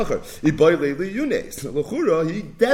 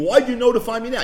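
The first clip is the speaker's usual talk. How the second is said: higher, louder, fast indignant complaint; indignant seller; 8 semitones higher, 5 dB louder, faster than usual. Retold or imitated. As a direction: demand it like a wronged seller. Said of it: "is he's timing".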